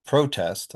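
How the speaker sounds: PRO-test with a high, full first vowel; first-syllable stress. Shown as a demonstration. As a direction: punch the PRO in protest. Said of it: "we've got a new verb".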